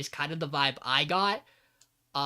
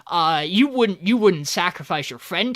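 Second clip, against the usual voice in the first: louder, hammed-up panic; mock panicked villain; 8 dB louder than usual. Villain realizing he's trapped, loud mock panic. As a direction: blurt it out like a spooked goon. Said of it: "the guy's like".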